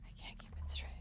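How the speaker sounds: soft breathy whisper to herself. Real, not acted. Gamer muttering at the portals, whispered. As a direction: hush it under your breath. Repeats the line, then whispers it.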